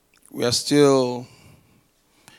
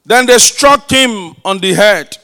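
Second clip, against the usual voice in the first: loud, forceful scripture reading; preaching scripture; 5 semitones higher, 10 dB louder than usual. Acted. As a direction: proclaim the passage to the whole church. Reads scripture at full force.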